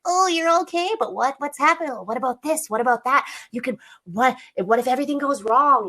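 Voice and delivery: high, whiny little mosquito voice. Retold or imitated. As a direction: needle them in a whiny little voice. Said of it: "with its whiny little voice".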